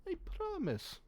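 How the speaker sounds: shifty merchant insisting to an officer, put-on Slovenian accent. Merchant lying to an officer, in a put-on Slovenian accent. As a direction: protest innocence in a mock Slovenian accent. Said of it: "I can do a Slovenian accent".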